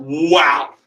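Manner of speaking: loud, impressed wow; amazed customer. Acted. Customer reacting to the knife cutting rope, amazed. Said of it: "What do you think that customer's reaction is gonna be?".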